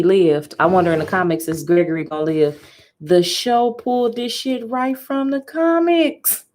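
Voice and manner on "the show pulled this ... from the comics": higher, slow, each word stretched; slow amazed emphasis; slow stunned disbelief. Real, not acted. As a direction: draw it out, amazed. Stunned reaction.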